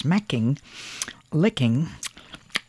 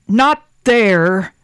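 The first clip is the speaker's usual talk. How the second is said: loud, high bellow; 8 semitones higher, 9 dB louder than usual. A narrator's reading. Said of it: "bellowed the woodcarver".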